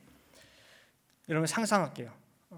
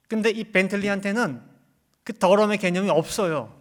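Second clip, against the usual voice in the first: loud, high-pitched sermon delivery; full-force preaching; 6 semitones higher, 13 dB louder than usual. Real, not acted. Preacher explaining sin and reproof to believers, full-force preaching. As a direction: drive each point home loudly to the congregation.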